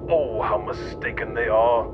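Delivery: ordinary talk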